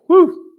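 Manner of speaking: high loud woo of relief; excited whoop. Real, not acted. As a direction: whoop like you just crossed the finish line. Relief after finishing the stats.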